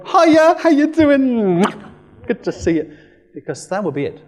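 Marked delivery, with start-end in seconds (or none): 0.1-3.0 high, loud gushing hello; loud bubbly greeting. Retold or imitated. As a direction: burst in all cheery and loud. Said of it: "into the blue energy and go".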